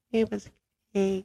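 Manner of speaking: high squeaky mock voice; high mock breathy reply. Mocking sex talk reply.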